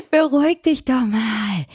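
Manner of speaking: laughing plea to calm down. Pretend. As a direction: soothe her while still giggling. Calming friend while laughing.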